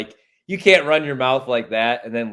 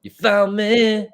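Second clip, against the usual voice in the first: silly put-on voice; 9 semitones higher, 3 dB louder, much slower than usual. Playful put-on voice.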